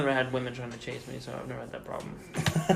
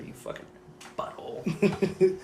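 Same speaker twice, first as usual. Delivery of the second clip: whispered insult; 5 semitones higher, slower than usual. Acted. Insulting imagined bully.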